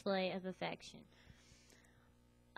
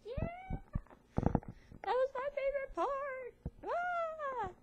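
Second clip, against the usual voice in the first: much higher, slow squeal; squealing moviegoer; much slower than usual. Retold or imitated. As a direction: gush like an annoying fan in the cinema. Mimicking loud moviegoers.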